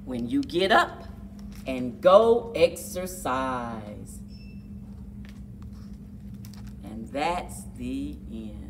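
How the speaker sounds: lively picture-book read-aloud; reading to children. A narrator's reading. Narrator reading a kids' exercise book, lively. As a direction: read it like a storybook for kids.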